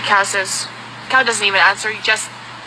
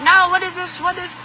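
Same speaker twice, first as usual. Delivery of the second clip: startled mother; 10 semitones higher than usual. Acted. Mother runs out, alarmed.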